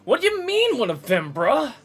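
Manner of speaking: high, loud outraged beach dude; angry surfer bro. Acted. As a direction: shout denials like a wounded surfer. Surfer bro denies loudly.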